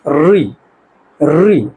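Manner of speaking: री with a light trill of the tongue; री said with a vibrating tongue. Shown as a demonstration. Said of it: "जीभ में भी कंपन होगा". (री को जीभ के कंपन के साथ बोलना)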